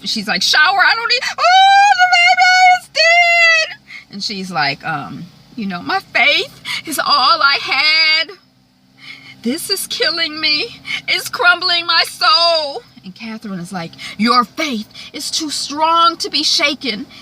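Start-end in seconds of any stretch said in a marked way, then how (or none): 0.4-3.8 hammed-up high, loud wail; crying wail. Hannah mourns the baby, crying wail. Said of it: "she starts crying again". 5.8-12.8 high, drawn-out tearful lament; acted anguish. Hannah tells Catherine her faith is crumbling, acted anguish. Grieving woman loses faith, crying. 14.2-17.1 raised, high exhortation; fervent insistence. Retold or imitated. Catherine bolsters Hannah's faith, fervent insistence.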